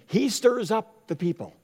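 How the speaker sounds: accusing crowd. Retold or imitated. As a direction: voice the crowd stirring blame. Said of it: "They were urgent, saying".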